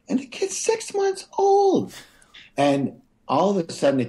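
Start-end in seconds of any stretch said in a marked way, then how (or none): 0.1-1.9 very high, drawn-out incredulity; appalled disbelief. Real, not acted. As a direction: stress the absurdity, pitch soaring, slowly. Appalled at his own screaming.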